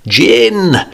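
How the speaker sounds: put-on boy roaring gin; child roaring. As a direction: bellow it like a cheeky kid. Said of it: "the child roared".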